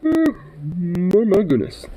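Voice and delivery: high, sweet pet talk. Real, not acted. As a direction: coo at the puppy.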